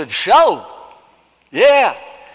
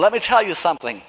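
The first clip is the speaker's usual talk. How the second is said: usual speaking voice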